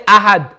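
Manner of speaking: Ahad with a plain stopped d; no echo after the d of Ahad; without qalqala. Shown as a demonstration. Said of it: "Don't say".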